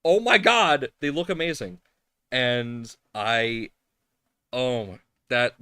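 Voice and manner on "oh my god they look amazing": high, loud thrilled gasp at a gift; gushing excitement. Retold or imitated. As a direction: squeal it out like you just opened a present. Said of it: "And I'm just like".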